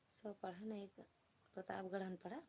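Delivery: soft, halting attempt at a saying; unsure recall. Real, not acted. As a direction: murmur it tentatively, trailing into a question. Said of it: "पता ना ऐसे कैसे कुछ बोलते हैं".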